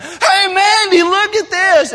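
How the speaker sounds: high, loud call to someone far away; excited yell to his sister over the pond; calling across a pond. Retold or imitated. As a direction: shout it across the water. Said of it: "we're yelling across this pond".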